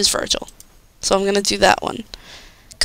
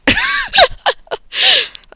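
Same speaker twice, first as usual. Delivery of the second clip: shrieking laugh. Real, not acted.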